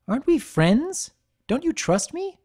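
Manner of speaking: high, wounded voice pushing for trust; hurt pleading. A narrator's reading. Barry presses Minuet, high and wounded. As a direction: sound offended she'd doubt you.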